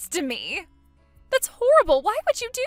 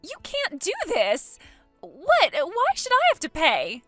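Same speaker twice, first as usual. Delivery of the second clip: louder, higher indignant cry; outraged protest; 5 semitones higher, 7 dB louder than usual. Acted. Bride told she must pay for wedding, outraged cry. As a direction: protest hotly, refusing the bill.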